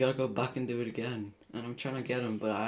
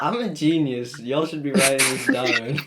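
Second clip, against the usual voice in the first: smug; 7 dB louder than usual. Retold or imitated. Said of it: "And then I was like".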